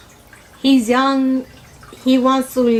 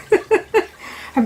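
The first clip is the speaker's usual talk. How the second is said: high laugh; 10 semitones higher than usual. Real, not acted. Laughs at own point.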